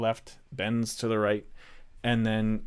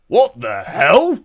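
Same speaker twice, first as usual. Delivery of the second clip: slow, much higher and louder startled guard; 11 semitones higher, 16 dB louder, much slower than usual. Acted. Guard on the tower startled by the dwarf, loud and slow. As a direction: bellow it down from the tower in shock. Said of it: "he goes".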